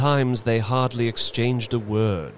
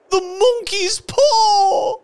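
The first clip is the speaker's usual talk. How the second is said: very high, loud, drawn-out shriek; wild cry; 7 dB louder, slower than usual. A narrator's reading. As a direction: scream it out slowly, frantic. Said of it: "she cried wildly".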